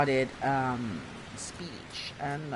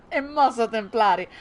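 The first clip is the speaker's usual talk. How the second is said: loud, raised Roman-accented Italian; 4 semitones higher, 6 dB louder, faster than usual. Shown as a demonstration. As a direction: repeat it loudly in Roman dialect. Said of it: "I start to speak in Roman".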